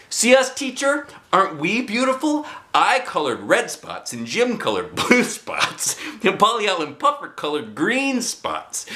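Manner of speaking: put-on a child showing the teacher; proud excited girl. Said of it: "she said".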